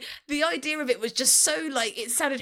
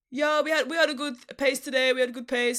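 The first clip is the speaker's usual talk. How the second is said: put-on Dutch accent, casual race debrief. Shown as a demonstration. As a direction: imitate a Dutch driver's post-race interview accent. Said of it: "just do the Dutch accent".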